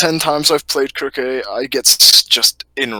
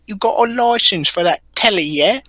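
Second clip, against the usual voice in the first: put-on Birmingham accent; 8 semitones higher than usual. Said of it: "he says".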